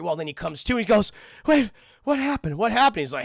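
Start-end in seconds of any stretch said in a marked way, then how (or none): 1.5-3.0 high, loud bewildered questions; dazed alarm. Acted. Mister Cunningham coming to after hitting a wall, high startled confusion. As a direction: ask it like someone jolted awake confused.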